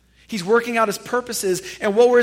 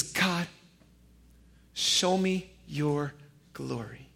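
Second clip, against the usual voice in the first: hushed plea; 6 semitones lower, 9 dB quieter, slower than usual. Acted. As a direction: drop to a soft, slow plea. Moses' hushed request.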